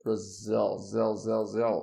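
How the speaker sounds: "Brazil" with an English z and a dark L, "zil" drilled. Shown as a demonstration. Teacher drilling the English "Brazil". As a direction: stress "zil" and end on a dark L. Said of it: "where do I live?".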